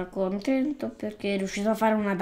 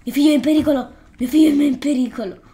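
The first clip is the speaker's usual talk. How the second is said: louder, slightly raised cry of alarm, a bit hammed-up; mock frantic mother; 4 semitones higher, 8 dB louder, much faster than usual. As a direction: call out in mock alarm for your child. Voicing a panicked game mother.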